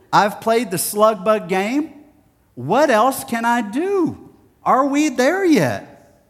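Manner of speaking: put-on high, loud child whine, slowing at the end; bored boy pleading from the back seat; exasperated kid. Child voice again.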